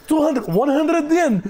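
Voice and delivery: playful broken tourist English; accent anglais blédard. Shown as a demonstration. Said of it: "anglais blédard". (marchandage en anglais blédard, voix aiguë)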